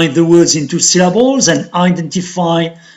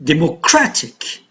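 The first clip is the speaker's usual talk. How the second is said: "Democratic" with CRA stressed; de-mo-CRA-tic, weight on CRA in "Democratic"; stress on third syllable; 2 semitones lower, 4 dB quieter than usual. Shown as a demonstration. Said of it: "the stress is on the syllable just before".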